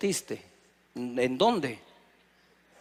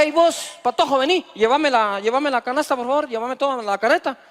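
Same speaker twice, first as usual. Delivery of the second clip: put-on high, loud yell to a boy across the store; man yelling in a supermarket; 8 semitones higher, 5 dB louder than usual. Pastor imitating a man yelling at a boy in the supermarket. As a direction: call out bossy and loud, hey vos patojo. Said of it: "un señor te grita".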